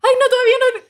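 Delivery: high, loud frantic self-pleading; hammed-up alarm, voice shooting up; acted-out panic. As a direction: replay a desperate last-second hold. Acting out panic of nearly wetting herself.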